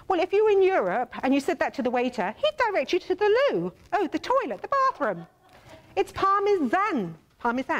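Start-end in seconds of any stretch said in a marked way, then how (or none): 6.0-7.8 slow Parmesan with a clear z, parm-i-zan; British Parmesan, slowly. Shown as a demonstration. Correcting viewers, slow careful British Parmesan.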